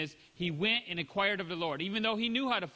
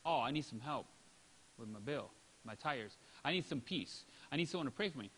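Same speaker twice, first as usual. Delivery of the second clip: much softer and lower, worn-out asking; weary plea; 7 semitones lower, 8 dB quieter than usual. Retold or imitated. Needy person telling Sister Francis, hushed and weary. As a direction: sigh out the needs quietly.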